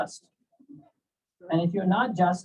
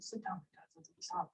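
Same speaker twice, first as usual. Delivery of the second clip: hushed calming; 5 semitones higher, 19 dB quieter, slower than usual. Retold or imitated. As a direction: quietly settle down an outraged student. Teacher quietly calms his angry student.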